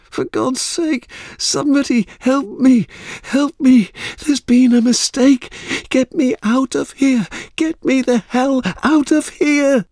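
frantic high, loud screams for rescue; terrified screaming. A narrator's reading. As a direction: scream and plead in desperate panic. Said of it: "she screamed".

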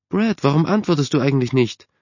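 high-pitched, annoyed mother; rather cross call. A narrator's reading. Mother calling her unresponsive son, rather cross. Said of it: "rief seine Mutter ziemlich sauer".

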